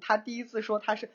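normal talking voice

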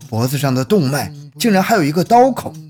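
usual speaking voice

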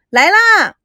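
high, loud shout across the house; loud call. A narrator's reading. Girl shouts to her impatient brother, high and loud. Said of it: "赵美娇边喊边奔出".

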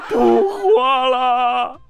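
high, slow, wailing despair; despairing young man. Retold or imitated. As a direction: wail it out in mock despair. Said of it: "小伙儿一听".

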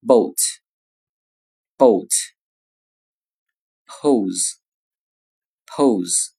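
each word drawn out twice, very slow; teacher drilling o words slowly; slow example words. Shown as a demonstration. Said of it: "OK，看例词".

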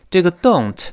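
ordinary delivery

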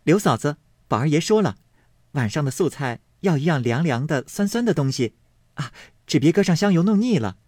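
put-on a lively young maid calling in, with a smile in her voice; cheerful girl. A narrator's reading. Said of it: "笑向厨房中柳家媳妇说道". (笑着说话的小丫头)

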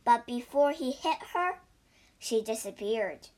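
usual speaking voice

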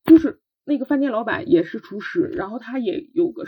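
usual speaking voice

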